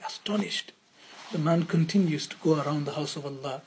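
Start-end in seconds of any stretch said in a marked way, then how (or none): 0.0-0.7 hushed, in wonder; awed whisper. Acted. Preacher telling the crowd Shibli was astonished, whispered. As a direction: breathe the word out in a whisper.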